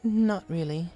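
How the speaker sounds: a hint of an elegant lady pony; Rarity voice. A narrator's reading. Rarity answers the bartender, lightly voiced.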